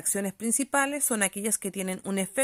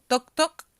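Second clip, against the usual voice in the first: knocking sound; 5 semitones higher, 8 dB louder than usual. Shown as a demonstration. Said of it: "cuando digo".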